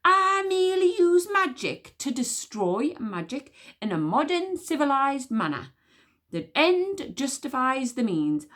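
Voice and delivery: high, loud royal command; imperious queen. Acted. Queen orders the witch smeller to hunt, loud and haughty. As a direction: talk down to a mere rodent.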